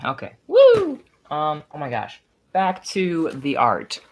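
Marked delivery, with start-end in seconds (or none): 0.5-1.0 very high, loud whoop; excited whoop. Real, not acted. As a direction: let out a piercing celebratory woo. Celebrating on the livestream.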